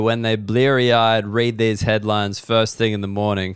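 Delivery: normal talking voice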